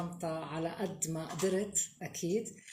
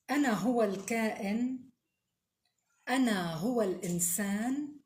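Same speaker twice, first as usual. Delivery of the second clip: solemn Arabic recital with long pauses; poem recitation; 5 semitones higher, 5 dB louder, faster than usual. Acted. (إلقاء شعري مهيب)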